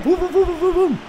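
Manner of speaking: rapid high-pitched string of booms; imitated ball noise. Real, not acted. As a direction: mimic the ball swerving through the air. Imita el vuelo del balón. (onomatopeya aguda del balón)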